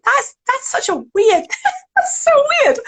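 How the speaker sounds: very high, giggly amazement; laughing disbelief. Real, not acted. Coach amused by her own odd trigger, laughing. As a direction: laugh through the words, pitch shooting up.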